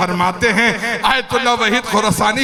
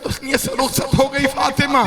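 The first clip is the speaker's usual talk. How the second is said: higher, half-sung grieving cry; mournful, wailing delivery; tearful lament; 4 semitones higher than usual. Acted. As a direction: repeat the death as a sobbing lament. Same death repeated in lament.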